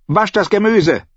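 high, bossy order; commanding. A narrator's reading. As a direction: give the order as a brisk landlady. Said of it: "befahl sie".

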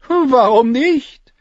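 high, loud, drawn-out comeback; cheeky retort. Acted. Tyrion answering his sister's question, cheeky and drawn out. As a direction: stretch it out high like a playful shrug.

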